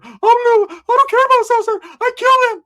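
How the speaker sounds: high, loud, strained put-on yell; mock yelling gangster. Ricky dragging old boy by the foot, high yell. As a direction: scream it like a wild man.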